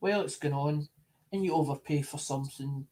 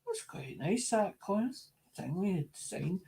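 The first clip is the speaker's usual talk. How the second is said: higher, softer appreciative put-on voice; mock-refined collector, higher and softer; admiring collector; 3 semitones higher, 7 dB quieter than usual. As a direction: hold it up high and soft, quietly charmed. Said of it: "looks at it and goes".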